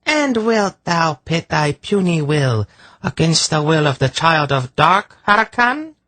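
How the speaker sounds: high-voiced, cool taunt; cool challenge. A narrator's reading. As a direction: challenge a rival coolly in a high voice. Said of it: "she asked coolly".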